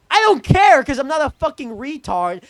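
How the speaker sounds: loud, high-pitched indignant retort; exasperated outburst. Acted. Storyteller dismissing the hoodie fuss, exasperated outburst. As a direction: burst out in exasperation.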